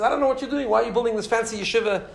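challenging. Retold or imitated. Wealthy man questions the rabbi's building, challenging him. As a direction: question him as a sceptical donor would. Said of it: "so he challenged him".

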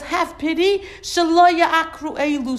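high, loud mock plea for pity; pleading king. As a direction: beg them to pity the people. Balak quoted pleading for pity.

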